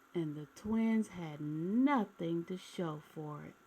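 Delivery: gentle read-aloud storytelling voice; storybook narration. A narrator's reading. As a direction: read it like a bedtime picture book for kids. Reading a picture book aloud.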